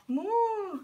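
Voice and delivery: put-on high playful moo for baby; high cow moo. Коровка, смотри.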